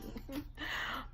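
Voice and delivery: small soft high chuckle; short giggle. Real, not acted. Vlogger amused at her own story, quiet giggle. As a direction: let a little giggle slip. Laughs while saying not laughing.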